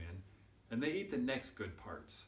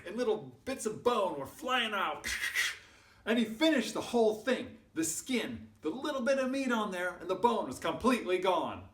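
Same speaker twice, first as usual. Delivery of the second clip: loud, high, thrilled narration; excited storytelling; 10 semitones higher, 7 dB louder than usual. A narrator's reading. Storyteller on the bone being devoured, loud and excited. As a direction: tell the climax at full excitement.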